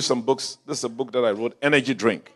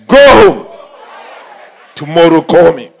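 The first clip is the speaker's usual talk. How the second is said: loud preached command; 5 semitones higher, 14 dB louder than usual. Retold or imitated. Pastor's quoted command, preached.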